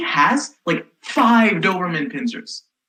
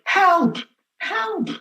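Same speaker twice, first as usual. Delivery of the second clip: retold scream; 13 semitones higher than usual. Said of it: "I am screaming at this point".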